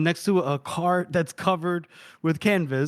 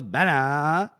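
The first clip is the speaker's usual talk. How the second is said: very slow, drawn-out whine with swooping pitch; hammed-up Valley girl confusion; Monza; much slower than usual. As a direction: stretch every word into a dopey whine. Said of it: "what voice are you doing now".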